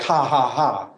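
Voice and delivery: dry mocking laugh spoken out; sarcastic ha ha. Shown as a demonstration. Pastor mocks the wrong sarcastic reading for his listeners. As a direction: toss off a scornful ha ha ha. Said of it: "This isn't sarcasm like".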